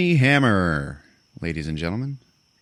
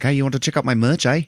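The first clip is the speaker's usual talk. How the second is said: higher, quick, hammed-up accent; mock Austrian accent; 8 semitones higher, faster than usual. Narrator voicing the arms dealer selling merch. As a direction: play the dealer with a goofy accent. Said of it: "Fucking Austrian?".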